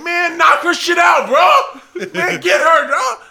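loud, high crowd shout; egging on. Retold or imitated. Crowd urging a girl to fight, egging her on. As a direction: imitate hyped-up onlookers.

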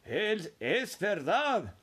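put-on slow, drawn-out chorus of old voices; four grandparents insisting in unison; elderly voices declaring it's true, slowly; old folks exclaiming together. A narrator's reading. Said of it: "exclamaron los cuatro ancianos al unísono".

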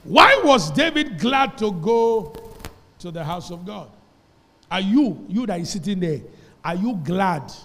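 booming preacher question; preaching at full force. Acted. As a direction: press the congregation hard. Preaching challenge to congregation.

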